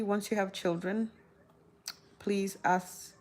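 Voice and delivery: ordinary delivery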